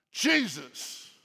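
usual speaking voice

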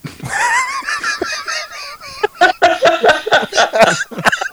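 loud high laugh bursting out; big laugh. Real, not acted. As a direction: cracks up loudly. Laughs at his own joke.

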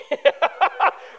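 breathy laugh at himself; self-mocking chuckle; real laugh. Real, not acted. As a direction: laugh it off, a little embarrassed.

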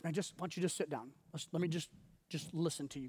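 softly voiced calming mentor; lightly acted patient listener; mentor soothing. Mentor calming the upset planter.

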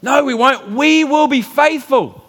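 high, loud, slow promise; eager group vow. Acted. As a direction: swear it together with zeal. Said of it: "they all said the same thing".

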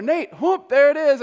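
high, loud greeting shout; loud call. Retold or imitated. Old man shouting the pastor's name at the door, loud and high. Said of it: "he bangs on the door".

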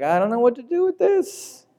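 flustered man. Acted.

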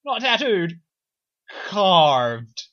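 "Carved" set apart, drawn out and loud; pause, then hit "Carved" hard and long; stress on Carved. Real, not acted. Stressing how the bullseye was made, loud drawn-out "Carved". Correcting a detail for emphasis.

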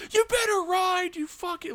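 put-on high, loud bratty kid yelling after him; kid's threat. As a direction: yell like a furious ten-year-old. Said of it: "The kid goes".

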